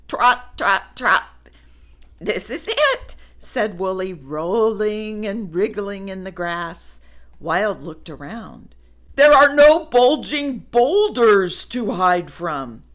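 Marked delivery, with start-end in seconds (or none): 2.2-3.1 very high, laughing joy; thrilled and giggly; delighted squeal. Acted. Woolly delighted. 9.1-12.8 emphatic and uneasy; alarmed observation; loud, wary. Acted. Wild wary.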